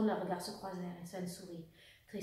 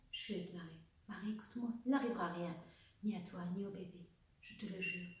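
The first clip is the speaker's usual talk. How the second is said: hushed, soothing promise; calm her gently, keeping the voice down; soft reassurance; 8 dB quieter than usual. A narrator's reading. Officer comforts his sobbing lover, softly reassuring. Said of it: "essayant de la rassurer".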